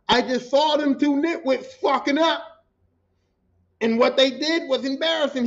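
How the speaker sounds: retold reprimand, loud and indignant; imitated angry boss. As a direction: chew them out like a big boss on the phone. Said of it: "That's how he said".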